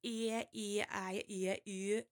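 exaggerated Geordie vowel demo. Shown as a demonstration. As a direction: make every vowel sound alike in Geordie. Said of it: "with the Geordies, it's like".

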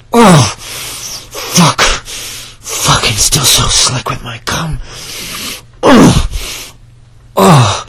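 rising from eager to loud high cries; mounting moans ending in loud high cries; building climax. Acted.

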